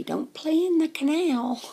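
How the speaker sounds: high, slow, loud cartoon warning; Dippity Duck. Safety film duck to schoolkids, cartoonish warning. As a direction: sing out a drawn-out mascot warning. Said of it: "He would say".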